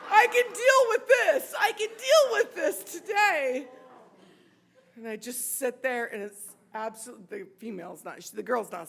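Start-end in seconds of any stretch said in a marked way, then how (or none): 0.1-3.7 loud, high, hammed-up elation; ecstatic relief. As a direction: shout it out, giddy with relief. Overjoyed relief, acted very big.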